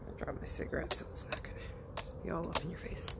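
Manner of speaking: hushed unvoiced aside to viewers; whisper. Real, not acted. Vlogger telling viewers about her cigarette, whispering. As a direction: whisper it to the camera.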